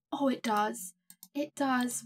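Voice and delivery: ordinary talk, nothing marked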